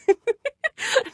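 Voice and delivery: high-pitched burst of giggles; mocking laugh. Laughing at a size claim, high mocking giggle. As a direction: break into high, loud laughter. Mocks a measurement.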